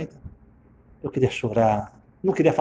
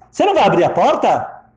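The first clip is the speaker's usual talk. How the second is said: raised, louder acted question; indignant demand; 5 semitones higher, 6 dB louder than usual. Locked-out wife to husband, indignant demand. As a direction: demand it through a closed door.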